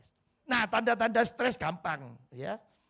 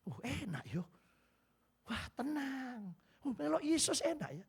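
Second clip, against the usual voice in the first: contented sigh; 4 semitones higher, 8 dB quieter than usual. Retold or imitated. Believer on a calm boat, soft contentment. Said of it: "saudara akan berkata begini".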